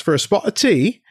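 a badly faked bit of British; kid's hammy attempt at British; horrible British accent. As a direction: lightly put on a bad British accent. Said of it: "that's a horrible British accent I just did".

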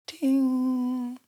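high sung ding like a tingsha bell; bell sound. Pretend. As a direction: chime it high like a little bell. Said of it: "'cause it's how he starts".

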